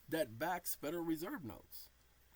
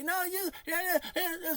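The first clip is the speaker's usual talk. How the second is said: mock voice of some people; 9 dB louder than usual. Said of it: "Some people say".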